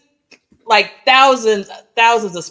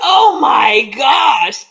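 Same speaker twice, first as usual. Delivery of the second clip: surprised exclamation; 5 semitones higher, much slower than usual. Retold or imitated. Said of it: "I'm like".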